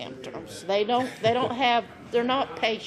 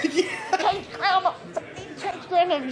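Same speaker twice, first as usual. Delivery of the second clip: higher, urgent plea mouthed on the sly; mock panic; 8 semitones higher, much slower than usual. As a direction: act out a covert panicked request. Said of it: "this is panic situation".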